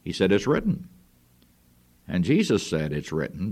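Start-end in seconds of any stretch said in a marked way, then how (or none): 0.3-0.9 devil's taunting "It's written." pitched higher with a rising end; mocking devil. Preacher voicing the devil taunting Jesus, mocking. 2.9-3.5 Jesus' "It's written," lower and softer; firm Jesus reply. Retold or imitated. Preacher voicing Jesus rebuking the devil, calm and firm.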